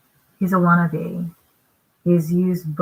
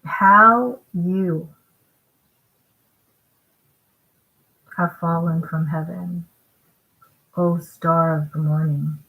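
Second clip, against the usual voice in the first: deliberate phrase-by-phrase reading, broken by pauses; slow it down, a long pause after "How you"; slower scripture reading. Shown as a demonstration. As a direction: read it out slowly with long pauses. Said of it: "So I'll read it again slower".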